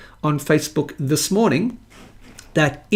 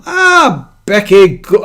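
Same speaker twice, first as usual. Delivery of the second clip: put-on loud, high burst of Scottish accent; 4 semitones higher, 9 dB louder, slower than usual. Host greeting a viewer by name, loud mock Scottish accent. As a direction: exclaim the name in mock Scots. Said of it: "in a Scottish accent".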